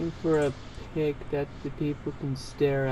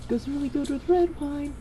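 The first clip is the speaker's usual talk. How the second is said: mock theme voice; 11 semitones higher than usual. Joking in theme voice.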